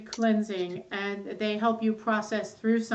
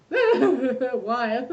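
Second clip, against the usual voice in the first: why said through a laugh. Retold or imitated. Friend apologizing for crying, laughing why. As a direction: laugh as you ask it again. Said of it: "And I was like".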